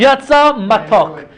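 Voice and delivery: drawn-out, emphatic riddle delivery; posing a riddle. Acted. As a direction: declaim it slowly like a challenge. Recites the riddle to the party.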